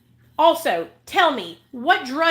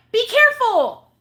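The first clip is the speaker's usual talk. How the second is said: exasperated; 11 semitones higher, 6 dB louder than usual. Real, not acted. Exasperated point.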